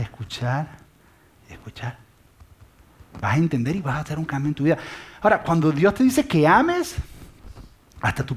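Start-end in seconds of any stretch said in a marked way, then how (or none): 0.0-2.0 hushed whisper. Acted. Preacher tells listeners they will hear, whispered. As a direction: whisper y escuchar, unvoiced. Sermon point trails to a hush.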